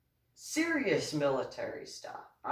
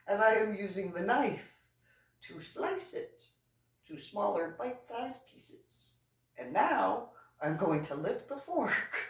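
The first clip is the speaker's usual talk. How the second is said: higher, exaggeratedly patient explaining; sarcastic step-by-step; 6 semitones higher than usual. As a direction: spell out every step as if to a child. Sarcastic answer to the professor.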